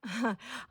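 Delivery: small quiet chuckle; real soft chuckle; soft laugh. Real, not acted. Amused at lip gloss mishap.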